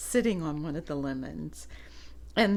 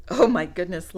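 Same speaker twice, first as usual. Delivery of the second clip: plain reading voice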